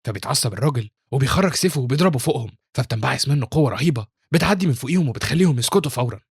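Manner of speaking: ordinary delivery, nothing marked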